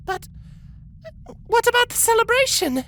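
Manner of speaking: sad plea. A narrator's reading. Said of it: "Sapphire asked sadly".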